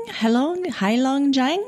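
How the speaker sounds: "Heilongjiang" with a questioning rise, unsure of it; tentative pronunciation. Real, not acted. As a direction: have an uncertain go at "Heilongjiang". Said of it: "I'm gonna try to say it".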